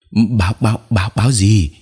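put-on flustered old villager stammering. A narrator's reading. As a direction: stutter on the first word in alarm. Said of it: "Ông Lãng lắp bắp".

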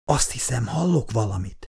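soft hushed voice; whisper. Acted. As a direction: say it softly, straining to listen. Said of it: "suttogta Lex".

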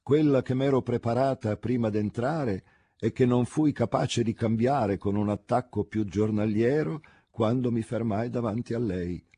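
ordinary delivery, nothing marked

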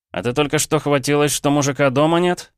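usual speaking voice

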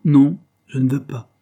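loud, high NON; irritated refusal. A narrator's reading. Henry refuses his friend's invitation.

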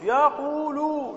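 laboured, slow "يقول" as yaq-wu-lu; heavy underlying form. Shown as a demonstration. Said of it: "ثقيلة".

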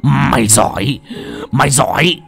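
loud savage roar through gritted teeth; furious, teeth gritted. A narrator's reading. Old man beating Lành, roaring through gritted teeth. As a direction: roar it while beating her. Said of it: "mà nghiến răng nghiến lợi".

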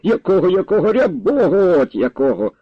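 high, irritated echo of the question; exasperated. Acted. Exasperated grandfather repeats lad's question.

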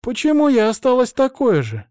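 put-on high, plaintive girlish voice; disappointed girl. Transformed girl asking why she is unchanged, high plaintive voice.

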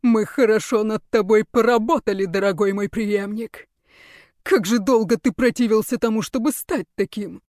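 loud gloating villain voice; gloating mad scientist. Acted. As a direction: savor the triumph. Ruler gloats over his creation.

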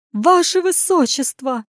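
high, slow cry of dismay; distressed call. Acted. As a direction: call out in drawn-out alarm. Lady cries out to the prince.

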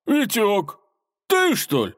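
high, loud astonished recognition; amazed exclamation. A narrator's reading. Robber recognising his old friend, amazed. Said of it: "изумлённо воскликнул он".

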